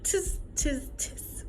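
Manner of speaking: high, mocking monkey noise; chattering monkeys. A narrator's reading. Monkeys mimicking the peddler's scolding, high and teasing.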